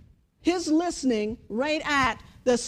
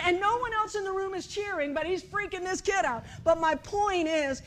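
ordinary talk

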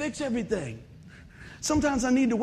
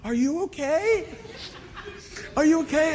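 laughing concern; 7 semitones higher than usual. Retold or imitated. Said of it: "they go".

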